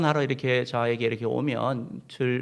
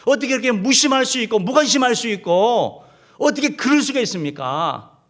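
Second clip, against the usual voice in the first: loud, raised voice of reproach to the Lord; resentful complaint; 8 semitones higher, 9 dB louder than usual. Retold or imitated. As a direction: cry out the disciples' grievance, high and loud. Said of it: "이렇게 원망하고 있다라는 거예요". (원망 섞인 큰 소리로 따지듯)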